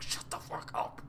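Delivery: hushed hiss. Acted. Hobbs silencing his men in front of gods, whisper. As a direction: shush them urgently. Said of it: "And Hobbs is like".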